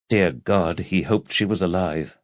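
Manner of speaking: ordinary talk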